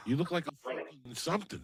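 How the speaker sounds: usual speaking voice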